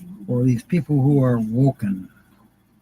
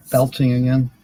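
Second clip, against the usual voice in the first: normal talking voice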